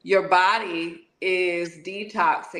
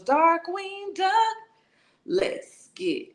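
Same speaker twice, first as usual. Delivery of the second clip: cartoon catchphrase; 10 semitones higher, slower than usual. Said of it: "That's mine".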